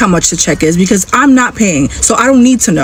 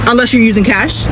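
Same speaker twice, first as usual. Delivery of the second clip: ordinary delivery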